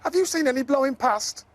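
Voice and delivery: Zippity voice. Acted. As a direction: ask hopefully, voice up high. Zippity asks Professor Croc.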